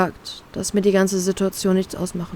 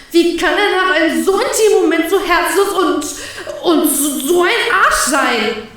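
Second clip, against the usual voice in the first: higher, anguished, half-laughing outrage; hurt disbelief; 7 semitones higher than usual. Acted. Heartbroken woman rants inwardly at him, higher and anguished. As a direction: rant at herself, voice climbing in pain. Inner outburst of hurt narrator.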